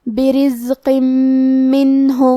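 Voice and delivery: برزق منہ recited with the tanween merged into meem, doubled and nasal; idgham with ghunna. Shown as a demonstration. Teacher reciting idgham for students, doubled nasal meem. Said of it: "غنہ اور تشدید کے ساتھ".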